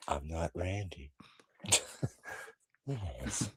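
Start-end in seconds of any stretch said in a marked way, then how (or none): 0.1-1.1 low, slow and quiet menace; slow, quiet creepy killer; Ghostface voice. Retold or imitated. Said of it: "I can still do that voice".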